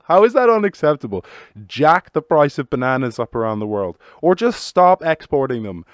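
loud, high rant; full-on banana rant; exasperated rant. Real, not acted. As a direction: shout it out in disbelief. Ranting at the idea.

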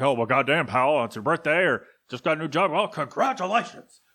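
blustering boss voice going all nuts; over-the-top caricature of a wrestling boss celebrating; Vince McMahon. Pretend. Boss sending a fan birthday congratulations, wildly hammed-up impression. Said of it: "you just go all nuts".

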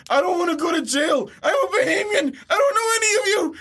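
high, near-crying panicked shouting; terrified of jail, rising to a cracking wail; panicked kid. Retold or imitated. Kid spotting the news helicopter, wailing in panic. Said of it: "I'm like".

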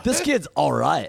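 slow approving dude drawl; cool dude. Acted. Puppy warming to the kid, cool dude. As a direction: let it roll out like a cool guy. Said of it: "has to be kind of a cool guy".

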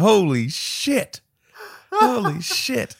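high, very drawn-out exclamation; stunned disbelief. Retold or imitated. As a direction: re-enact shock, stretching each word slowly. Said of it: "I was like".

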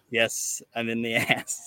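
normal talking voice

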